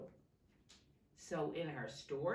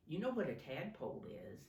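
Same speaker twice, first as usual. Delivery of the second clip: plain reading voice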